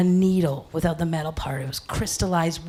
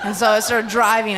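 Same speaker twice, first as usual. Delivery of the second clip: ordinary talk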